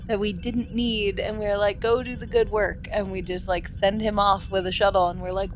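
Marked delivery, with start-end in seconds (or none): none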